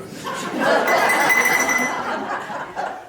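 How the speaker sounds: breathless burst of laughter; loud airy laugh. Acted. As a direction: laugh hard, mostly breath. Laughing at own joke.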